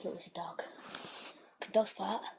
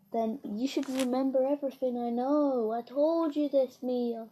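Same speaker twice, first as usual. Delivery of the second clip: usual speaking voice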